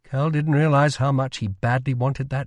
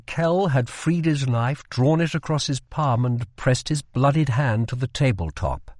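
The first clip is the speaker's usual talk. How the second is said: plain reading voice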